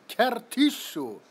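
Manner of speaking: loud, slow, high mock sneeze; British sneeze. Repeating a viewer's British sneeze word, loud slow mock sneeze. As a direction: sound out the sneeze slowly and loud. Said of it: "Is that a British thing?".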